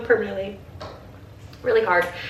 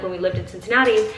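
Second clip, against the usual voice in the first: ordinary talk, nothing marked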